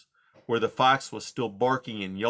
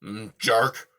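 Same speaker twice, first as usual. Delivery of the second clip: scornful; 4 dB louder than usual. Acted. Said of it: "Aerith said scornfully".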